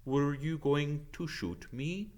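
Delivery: a hint of a grave old centaur. Ronan greets Hagrid, slow and solemn.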